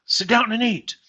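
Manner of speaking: put-on high, heavy German accent on the slogan; old German waitress accent. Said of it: "they'd have that old German accent".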